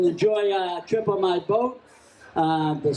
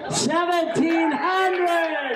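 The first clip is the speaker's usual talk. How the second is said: higher, long drawn-out shout; excited bid call; 9 semitones higher, much slower than usual. Real, not acted. Auctioneer announcing a last-moment bid, excited drawn-out shout. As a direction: stretch the new number out in triumph.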